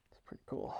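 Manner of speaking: whispered under the breath; whispering. Real, not acted. Whispered aside inside.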